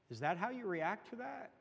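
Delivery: retold puzzled challenge, pitch climbing high; taken-aback question. Teacher answering the scoffer in Sunday school, taken aback.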